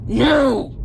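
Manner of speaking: put-on loud childish no; yelled refusal. Kid refusing his mom's spanking, yelled. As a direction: shout it like a kid dodging a whipping.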